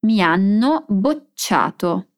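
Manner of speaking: "Mi hanno bocciato" with long held NN in "hanno" and CC in "bocciato"; slow double consonants. Shown as a demonstration. Teacher drilling the double consonants for students, slow and careful. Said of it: "Note o som de dois N's e de dois C's".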